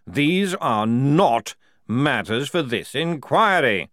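loud, slow, emphatic ruling; angry general. Acted. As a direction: thunder it out word by word. General slams fist.

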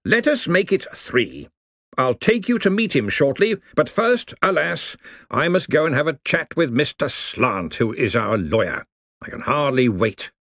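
genial senior wizard, pleased; beaming old wizard. Acted. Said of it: "Ridcully beamed".

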